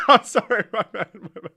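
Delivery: high, helpless laughing; real laughter breaking through the words; laughing apology. Real, not acted. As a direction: apologize while cracking up. Cracks up after own impression.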